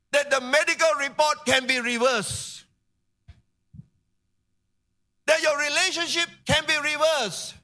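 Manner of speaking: high, ringing sermon delivery; impassioned preaching. Real, not acted. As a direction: preach it with building urgency, pitched high. Preaches at full force.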